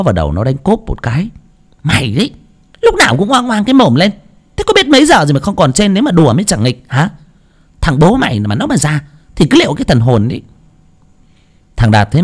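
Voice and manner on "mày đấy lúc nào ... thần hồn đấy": scolding mother. A narrator's reading. Mother scolding her son.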